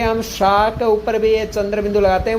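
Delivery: ordinary delivery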